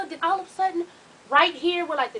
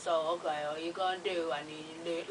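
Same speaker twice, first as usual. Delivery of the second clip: low, soft, jaw held shut; locked-jaw mumble, low and quiet; talking through clenched jaw; 8 semitones lower, 10 dB quieter than usual. Shown as a demonstration. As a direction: speak with your jaw clenched. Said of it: "I'm talking like this".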